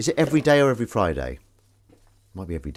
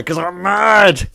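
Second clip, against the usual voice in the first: mock mad cry, higher, louder and slow; mock madwoman; 9 semitones higher, 10 dB louder, much slower than usual. Vlogger pretends to be mad over a plate, hammed-up. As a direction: ham up a crazy person. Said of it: "Oh, not really".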